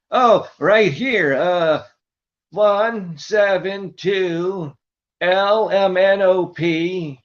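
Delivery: high, very slow and muddled; Buddy voice. Retold or imitated. Buddy giving a nonsense number to the clinic, high and slow. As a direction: spell it out slowly as a dim old man. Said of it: "that was Buddy".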